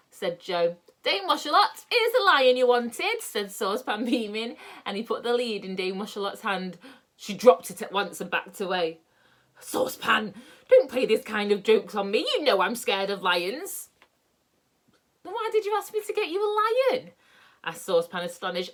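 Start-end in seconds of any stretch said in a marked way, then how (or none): 1.0-3.2 higher, louder, slowed presentation; proud old Saucepan. Acted. 9.6-13.9 louder, alarmed scolding; frightened old dame. Acted. 15.2-17.1 much higher, bewildered question; puzzled old man; astonished Saucepan. Acted.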